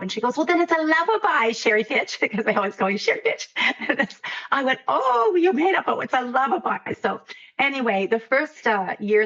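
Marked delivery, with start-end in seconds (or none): 0.4-2.2 higher-pitched, lightly voiced little girl; imitating a small child's quick realization. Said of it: "a little girl in the Yukon". 4.9-6.9 reenacted delight at a child's invention; delighted surprise. Retelling her reaction to the child.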